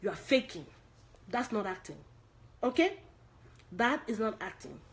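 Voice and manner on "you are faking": usual speaking voice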